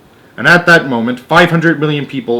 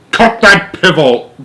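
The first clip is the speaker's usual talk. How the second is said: slow, high, loud snap; snapped; 7 semitones higher, 7 dB louder than usual. A narrator's reading. The President orders Shanks to stop, snapping. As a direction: bite the order off. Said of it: "Shanks snapped the President".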